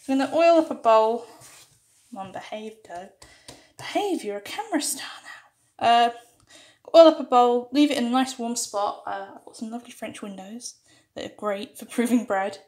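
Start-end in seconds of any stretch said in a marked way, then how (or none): none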